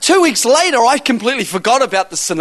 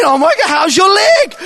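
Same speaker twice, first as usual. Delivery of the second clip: high, slow, acted holler; excited shouting; 9 semitones higher, 4 dB louder than usual. Preacher asking the healed cashier about her leg, shouting. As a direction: act out an excited question shouted over the shop. Shouted exchange across the store.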